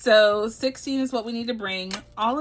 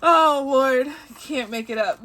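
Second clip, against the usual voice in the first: normal talking voice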